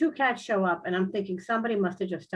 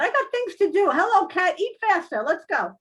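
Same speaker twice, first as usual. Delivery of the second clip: higher, loud hurry-up; impatient nagging; 8 semitones higher, 5 dB louder than usual. Retold or imitated. Telling the cat to eat faster, higher and impatient. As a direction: rush the cat, exasperated.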